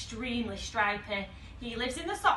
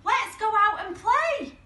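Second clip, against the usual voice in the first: high, loud and eager; excited invitation; 10 semitones higher, 6 dB louder than usual. Acted. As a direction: call a friend out to play. Simon urges partner to play.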